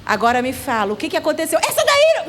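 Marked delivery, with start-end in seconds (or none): 1.6-2.3 high, sudden outburst; high-pitched childish accusation; imitated angry child. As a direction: burst in like a child still upset. Retold child bursts out again.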